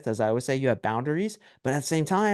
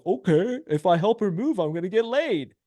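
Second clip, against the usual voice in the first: higher-pitched mock-hopeful guy; eager naive guy; 6 semitones higher than usual. Guy asked to help her move, mock eager and naive. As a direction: imitate a guy who thinks he's scored.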